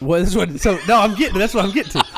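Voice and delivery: high, loud, laughing protest; laughing interjection. Real, not acted. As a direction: laugh while cutting him off. Cutting in with a laugh.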